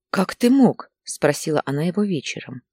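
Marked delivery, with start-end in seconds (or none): 0.1-0.9 reproachful outburst. A narrator's reading. Wife confronts Ilya over pills.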